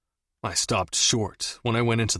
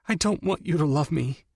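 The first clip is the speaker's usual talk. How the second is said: higher, pleading; tearful plea; 6 semitones higher than usual. A narrator's reading. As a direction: beg him to stop. Audrey pleading.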